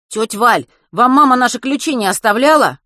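put-on loud, high, boyish question; urgent asking. A narrator's reading. Boy asking neighbour for spare keys, urgent. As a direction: ask eagerly through the door gap.

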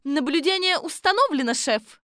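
high, loud assistant's voice; high-voiced report to the boss; Kolbochkina reporting. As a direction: pitch it up, reporting to the chief.

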